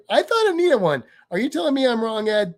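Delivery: high-pitched disbelief; incredulous protest. Real, not acted. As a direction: challenge it, voice shooting up high. Disputes Ed's claim in the chat.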